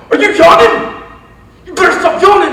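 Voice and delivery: high, full-volume yell; screaming coach. Retold or imitated. Coach catching players yawning on his first day, screaming. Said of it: "he was just screaming, yelling".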